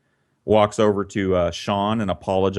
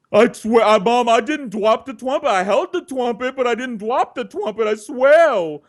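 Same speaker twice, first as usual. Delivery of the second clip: put-on very high, frantic childish protest; hammed-up kid who can't say his R's, loud at both ends; pleading little boy; 12 semitones higher, 5 dB louder than usual. Little Sean swears innocence to his mom, desperate.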